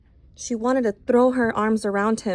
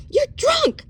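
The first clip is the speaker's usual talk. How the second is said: very high shrieked accusation; yelling. Acted. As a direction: hurl it at the drunk sheriff. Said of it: "she yelled".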